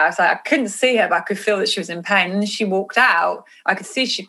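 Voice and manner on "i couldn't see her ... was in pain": ordinary talk, nothing marked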